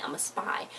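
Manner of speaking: hushed spy confession; whispered aside. Retold or imitated. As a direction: drop to a secret whisper. Spy whispers a secret identity.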